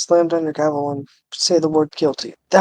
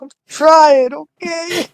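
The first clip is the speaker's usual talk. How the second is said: loud, high protest; defensive outburst; 11 semitones higher, 8 dB louder, much slower than usual. Real, not acted. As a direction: insist loudly you're trying. Defending his voice acting.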